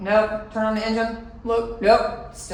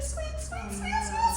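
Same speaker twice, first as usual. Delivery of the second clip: much higher, soft squeaky squeaks; rat squeaks; 7 dB quieter, slower than usual. Retold or imitated. Hearing the rat on her roof, soft high squeaks. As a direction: mimic a tiny rat squeaking.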